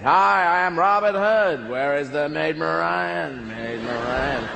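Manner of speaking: slow, hammed-up American seducer. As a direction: drawl it like a cheesy Hollywood lover.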